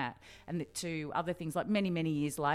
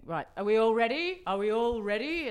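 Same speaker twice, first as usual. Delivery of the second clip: higher, louder imitation of a speaker calling the room to order; Gough Whitlam; 8 semitones higher, 6 dB louder than usual. Politician to press conference on gerrymandering, imitated commanding voice. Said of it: "In that Gough Whitlam voice".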